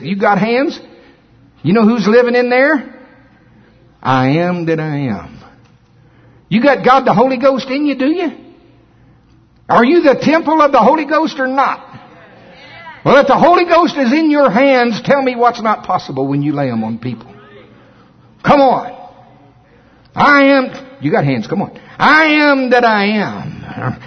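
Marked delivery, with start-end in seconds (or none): 1.6-21.7 preaching at full force. Acted. 22.0-24.0 slow, drawn-out proclamation; solemn declaration. Acted.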